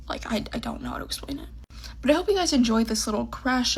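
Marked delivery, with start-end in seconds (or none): 0.1-1.5 tearful whisper. Real, not acted. Crying, at a loss for words.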